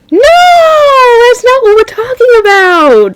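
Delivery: high drawn-out protest; exasperated correction. Retold or imitated. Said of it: "And I'm like".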